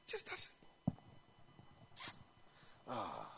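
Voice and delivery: quiet awed echo; breathy hush. Real, not acted. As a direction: breathe the words out, almost silent.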